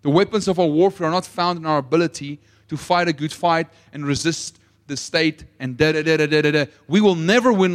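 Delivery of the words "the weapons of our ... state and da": ordinary talk, nothing marked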